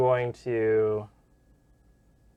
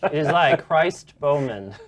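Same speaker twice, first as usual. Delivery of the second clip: grand announcer voice; 3 semitones higher, 10 dB louder than usual. Pretend. Echoing the grand introduction.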